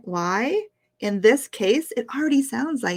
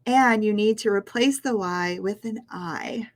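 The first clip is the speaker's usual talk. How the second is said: ordinary delivery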